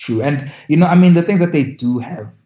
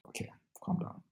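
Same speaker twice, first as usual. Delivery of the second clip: whispered aside; hushing whisper to her friend; mock calm down; 21 dB quieter than usual. Friend whispers to the other to calm down. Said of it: "I was gonna be like".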